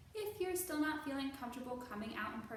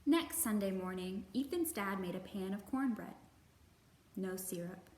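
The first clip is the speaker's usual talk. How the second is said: put-on gentle, measured read-aloud for children; storybook narration; 3 semitones lower than usual. A narrator's reading. Librarian reading a picture book to kids, gentle narration.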